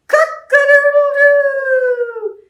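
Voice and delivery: high, slow, loud crowing; rooster crow. Acted. Storyteller crows for the rooster to wake the farmer. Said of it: "he heard".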